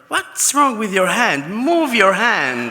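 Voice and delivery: high, loud mock demand; exasperated command. Boss telling the model to move her hand, exasperated. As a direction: order it impatiently, dragging out the last command.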